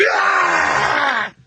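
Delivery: high, strangled scream held back; imitating wife trying to hold in her vomiting; retold vomit scream. Caller recounting his wife at five am, strangled scream. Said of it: "this is what I hear".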